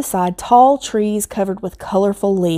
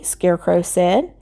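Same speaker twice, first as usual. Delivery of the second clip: plain reading voice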